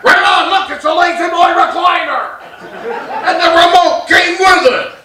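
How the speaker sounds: high, loud, thrilled exclamation; excited shout. Acted. Newborn dad-to-be marvels at his recliner, excited shout. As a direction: shout it with mock delight.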